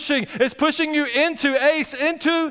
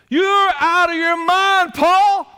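loud, high, slow outburst; loud retold outburst at Paul; loud exclamation; 7 semitones higher, 4 dB louder, much slower than usual. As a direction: exclaim it like Festus losing patience. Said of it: "in a loud voice".

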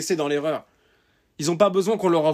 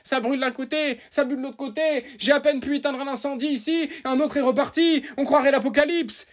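higher, whining voice of a worried brother; mock whiny complaint; 9 semitones higher than usual. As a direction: mimic a panicking brother listing disasters. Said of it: "Il suffit pas de dire". (imitation plaintive et aiguë d'un frère paniqué)

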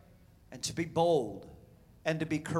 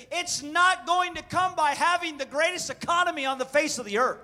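loud, rising sermon cry; preaching; 9 semitones higher, 7 dB louder than usual. Real, not acted. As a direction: preach it hard to the crowd. Preaching at full force.

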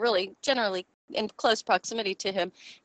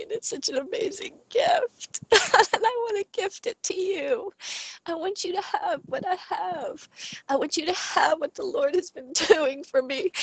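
tearful; 9 semitones higher than usual. Real, not acted. Testimony, voice breaking into tears.

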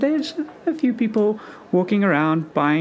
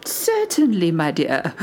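put-on very old woman. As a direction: coo it like a kind old lady. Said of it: "a very old lady".

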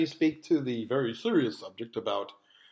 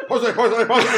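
catcall; 5 semitones higher, 5 dB louder than usual. Pretend. Joking catcall variant.

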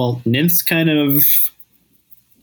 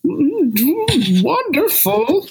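much higher, slow dreamy character voice; high airy nymph voice; much slower than usual. Acted. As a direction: float the word up high like a dainty nymph. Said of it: "I talk like I'm made out of croissants".

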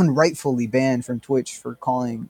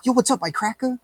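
mock taunt; 10 semitones higher than usual. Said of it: "you're like".

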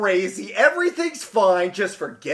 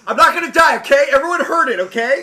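excited; 6 semitones higher, 5 dB louder, faster than usual. Pretend. Paraphrasing joyful boy.

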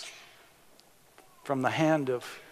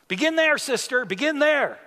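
higher and louder call of encouragement; shouted back; 9 semitones higher, 6 dB louder than usual. Retold or imitated. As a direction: cheer her on from the pews, much higher and louder. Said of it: "they shouted back".

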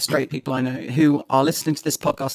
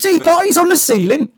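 hammed-up mock cockney accent, pitched higher; 12 semitones higher, 7 dB louder than usual. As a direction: play a Mary Poppins chimney sweep in a cockney accent.

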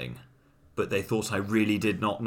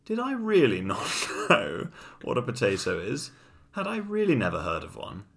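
higher-pitched retold disbelief; incredulous; 5 semitones higher than usual. Girlfriend's parents question him about the potato, very incredulously. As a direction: ask it as if you cannot believe it. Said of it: "very incredulously".

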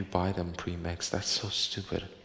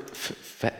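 normal talking voice

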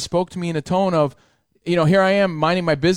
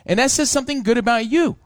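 ordinary talk

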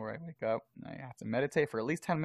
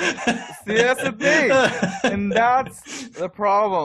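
laughing; 11 semitones higher, 11 dB louder, much slower than usual. Real, not acted. Laughing at his bragging worry.

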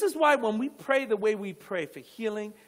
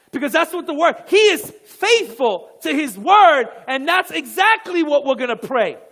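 emphatic preaching; 6 semitones higher, 12 dB louder than usual. Real, not acted. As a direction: proclaim it forcefully to the congregation.

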